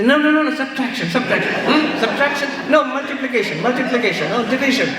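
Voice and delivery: high, hurried flurry of guesses; panicky guessing child. Retold or imitated. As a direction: blurt each option hoping one sticks.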